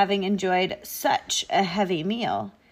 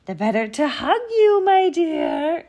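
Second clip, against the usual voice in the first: high, loud cooing disguise; rising, over-eager old-lady falsetto; wolf as Granny; 9 semitones higher, 7 dB louder than usual. A narrator's reading. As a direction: coax her in a fake granny voice.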